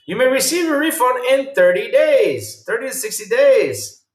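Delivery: worked-up disbelief; indignant reading. Real, not acted. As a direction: read it like you can't believe it. Reading policy in outrage.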